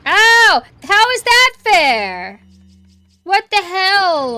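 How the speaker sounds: high, loud, slow outraged cry; outraged yelling. Real, not acted. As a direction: yell at the unfair hit. Getting hit in the game.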